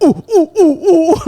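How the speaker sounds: high, loud bursts begging to be picked; eager kid's "ooh ooh". Retold or imitated. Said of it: "kept raising his hand like".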